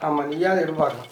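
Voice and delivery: low, soft, subdued voice; gentle reassurance. Acted. Uncle telling child to study well, soft and reassuring. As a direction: soothe the child quietly.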